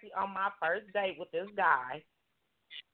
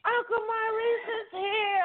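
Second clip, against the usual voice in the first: laughing panic; 13 semitones higher, much slower than usual. Retold or imitated. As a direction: blurt it while laughing in panic. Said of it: "my cousin was laughing".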